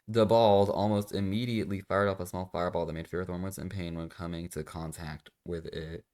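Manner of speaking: ordinary talk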